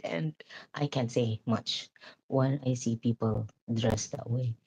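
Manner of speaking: hushed, almost whispered confession; sheepish whispered aside. Real, not acted. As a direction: breathe it out self-consciously. Admitting shyness about dress.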